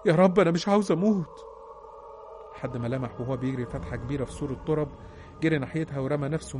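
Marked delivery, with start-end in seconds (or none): 0.1-1.3 louder, higher desperate cry to God; narrator's acted cry, pushed up in pitch and volume; screamed plea. A narrator's reading. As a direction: raise it into a pleading shout for life. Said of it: "فضل يصرخ ويقول".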